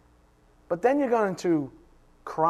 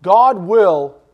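God will hit loud and hard; louder, God will humiliate you punched; loud stress on God; 3 semitones higher, 12 dB louder than usual. Shown as a demonstration. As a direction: proclaim God at full force. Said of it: "this is gonna require some concentration".

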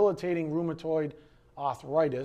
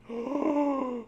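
mock gasp; 8 semitones higher than usual. Mocking a scary commercial.

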